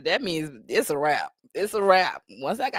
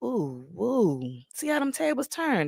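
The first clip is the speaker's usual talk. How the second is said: usual speaking voice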